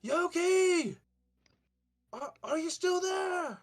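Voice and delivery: high, loud worried call; calling down a hole. Acted. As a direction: shout down into the pit. Chad calls into the hole.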